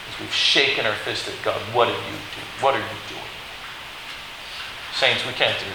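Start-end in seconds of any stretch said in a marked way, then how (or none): 1.7-3.3 low, soft accusing question; hushed challenge. Acted. Sinner shaking a fist at God, low and hushed.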